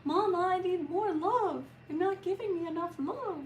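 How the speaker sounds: high, whiny voice for the dog; high pleading puppy voice; voicing the dog. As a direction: speak as the needy little dog begging for love. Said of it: "she'll usually tell me she's upset and say".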